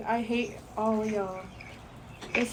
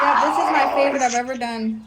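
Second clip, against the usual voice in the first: loud, thrilled exclamation; excited shout; 8 dB louder than usual. Real, not acted. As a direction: shout out your joy. Thrilled with her painting.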